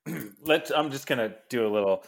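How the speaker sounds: usual speaking voice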